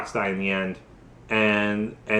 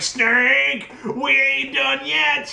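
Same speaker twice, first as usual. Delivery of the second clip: Liquid Snake; 11 semitones higher, much slower than usual. Retold or imitated. Villain returns, calling out.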